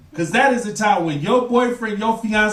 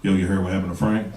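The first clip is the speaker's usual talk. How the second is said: much lower, hushed bro voice; gossiping buddy; 14 semitones lower, 4 dB quieter, faster than usual. Retold or imitated. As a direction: lean in low like spreading bad news. Friend gossiping about married buddy.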